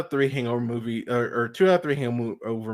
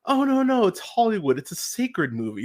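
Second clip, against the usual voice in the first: mocking whine; 10 semitones higher than usual. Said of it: "people had the nerve to go".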